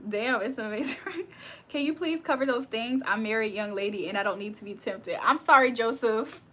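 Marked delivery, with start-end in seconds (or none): none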